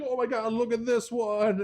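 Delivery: high, loud excited squeal of delight; excited high-pitched mimicry; gushing girlfriend. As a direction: imitate a girlfriend gushing over a puppy. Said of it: "And then she's like".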